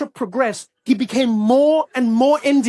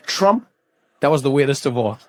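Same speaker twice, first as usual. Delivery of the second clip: ordinary talk, nothing marked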